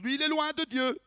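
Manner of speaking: high-pitched mock verdict on another believer; mock judging. Preacher voicing those who judge the undemonstrative, mock judging. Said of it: "ils disent".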